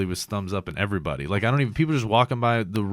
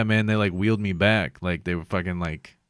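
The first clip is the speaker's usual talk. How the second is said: normal talking voice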